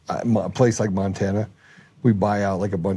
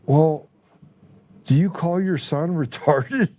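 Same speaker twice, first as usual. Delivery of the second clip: mock questioning; 5 semitones higher, much slower than usual. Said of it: "And I was like".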